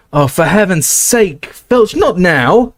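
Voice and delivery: slow, rising, fed-up snap; exasperated. Acted. McGonagall, fed up with Filch, drawn out. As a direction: drag it out, thoroughly fed up.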